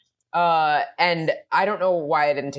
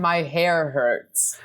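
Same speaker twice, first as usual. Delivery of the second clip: 1 quoted TV line; 3 semitones lower, much slower than usual. Retold or imitated. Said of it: "I liked the line".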